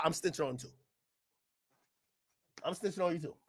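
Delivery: low muttered threat. Acted.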